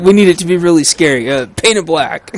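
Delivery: usual speaking voice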